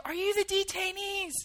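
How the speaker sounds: high-pitched call across a field; retold distant yell. Soldiers calling to captives across a field, high retold yell. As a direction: imitate someone hailing from far away. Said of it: "yell from across the field".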